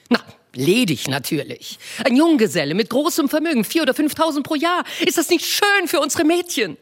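Mrs Bennet voiced high and bright-eyed, fussing; a high, eager matron gushing to her husband; eager wife. Wife to her husband with news, eager high voice.

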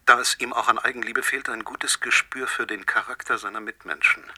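normal talking voice

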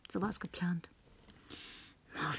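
vertrauliches Flüstern. Acted. Vertrauliches Erzählen.

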